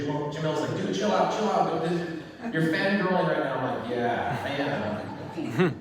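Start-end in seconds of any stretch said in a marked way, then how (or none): 0.8-3.5 imitating a teasing friend; teasing chill out. Friend calming him. 3.9-5.8 very slow, laughing yes; sheepish laughing confession; laughing admission. Retold or imitated.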